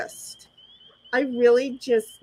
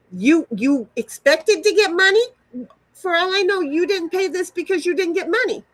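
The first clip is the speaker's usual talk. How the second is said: loud, higher, angry retort; 5 semitones higher, 4 dB louder than usual. Retold or imitated. Retelling her angry reply to the auntie. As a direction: tell her off hard. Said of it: "my response was".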